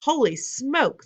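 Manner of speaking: high, slow drawn-out yell. A narrator's reading. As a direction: stretch it out in alarm. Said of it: "the man was yelling".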